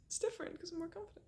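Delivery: fast, hushed whisper; whispered quote. Retold or imitated. Ex explaining his new relationship, a quick whisper. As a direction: tell it like a secret, quickly.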